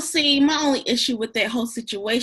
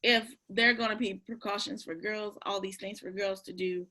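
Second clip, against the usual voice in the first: quiet; 3 semitones lower, 10 dB quieter than usual. Real, not acted. S2 drops to near whisper.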